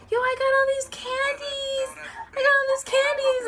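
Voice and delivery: put-on high, amazed little boy; kid voice. A boy waking up to find candy, high and amazed. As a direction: play the boy thrilled at his candy. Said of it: "He would wake up and be like".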